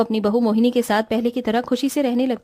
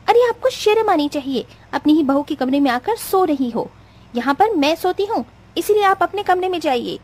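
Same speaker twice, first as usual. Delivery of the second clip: high, angry scolding of the mother-in-law; furious bride; 5 semitones higher than usual. Acted. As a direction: wake her up in a rage.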